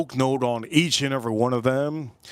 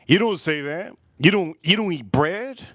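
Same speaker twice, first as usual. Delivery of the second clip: normal talking voice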